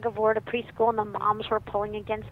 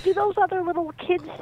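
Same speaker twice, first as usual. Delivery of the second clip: put-on high, loud, incredulous child; little boy's voice; 7 semitones higher, 5 dB louder than usual. Little boy questions Mom about the other kids, earnest. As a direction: ask like an earnest small kid.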